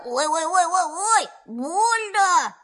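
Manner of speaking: high yelping cries of pain. Acted. Pooh yelps in pain while Rabbit pulls him. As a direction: yelp as you are pulled. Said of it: "Винни не закричал".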